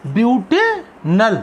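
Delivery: Butanal drawn out, paused before नल; slow dictation. Shown as a demonstration. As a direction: split butanal slowly into ब्यूटे and नल. Teacher dictates name in parts.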